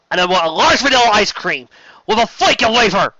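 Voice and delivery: high, loud, gleeful punchline; comic burst. Acted. Sheridan ending a grim list with a joke, gleeful. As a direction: swing suddenly into silly glee.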